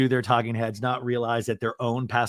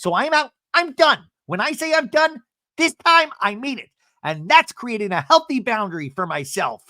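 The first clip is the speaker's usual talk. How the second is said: high, fast, heated rant; imitating a heated declaration; mock tirade; 8 semitones higher than usual. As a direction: rattle off the speech like a worked-up diva. Shannon's big speech, retold.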